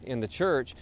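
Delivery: normal talking voice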